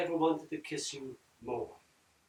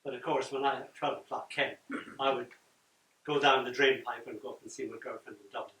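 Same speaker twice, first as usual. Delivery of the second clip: usual speaking voice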